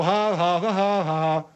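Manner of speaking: spoken-out singsong ha-ha; imitated singsong laugh. General lecturing Bud on angering people, singsong imitation. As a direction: mimic a needling laugh. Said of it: "come out like a song".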